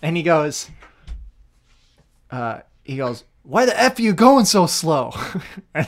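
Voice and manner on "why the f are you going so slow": louder, higher mock yell at the worker; imitating boss yelling. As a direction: bark it like an angry landscape boss. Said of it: "he screamed".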